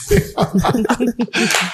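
high fond laugh at granny. Real, not acted. Son recalling grandmother's scolding, high fond laugh. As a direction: laugh out at the memory.

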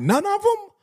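mock the mother's disbelief, high; incredulous mom. Mother doubting her son, high and incredulous.

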